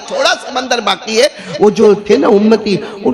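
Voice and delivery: high, sustained preaching cadence; rousing sermon narration. Acted. Preacher narrating crossing behind the prophet, rousing.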